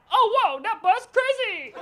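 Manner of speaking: high, drawn-out phony amazement; fake enthusiasm. Lying to his friends about butts, high fake enthusiasm. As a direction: overdo being impressed to fit in. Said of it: "I gotta lie".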